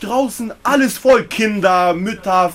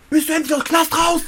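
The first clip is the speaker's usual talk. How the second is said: high excited call across the street; 8 semitones higher than usual. Retold or imitated.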